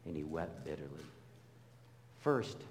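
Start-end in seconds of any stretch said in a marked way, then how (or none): 0.1-1.0 soft, low, near-whispered; almost breathe the words wept bitterly; quiet grieving read; hushed reading. Shown as a demonstration. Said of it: "and then Pastor Doug ... wept bitterly".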